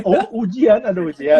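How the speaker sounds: laughing. Real, not acted. Laughing at exam news.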